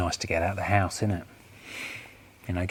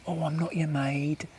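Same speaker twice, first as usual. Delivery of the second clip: mock a wife's scolding, pitched well up; retold yell in a high complaining voice; imitated yelling wife; 7 semitones higher than usual. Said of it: "She starts yelling at me".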